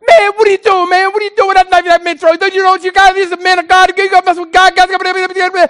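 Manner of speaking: high, loud and fast New York Puerto Rican woman; fast New York Puerto Rican talk. Retold or imitated. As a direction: rattle it off like a panicked New York street girl. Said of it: "Evelyn started talking in her fast Puerto Rican, New York talk".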